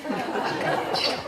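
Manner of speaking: whispered with a laugh, slowly; 속삭이듯 웃으며. Shown as a demonstration. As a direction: breathe the dialect phrase out through a chuckle, slowly. (웃음 섞인 속삭임으로 천천히 "아까 멘치로")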